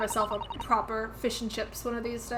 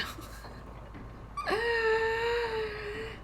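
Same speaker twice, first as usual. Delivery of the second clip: high laugh; 12 semitones higher, 3 dB quieter than usual. Real, not acted. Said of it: "What if I just made everyone very southern".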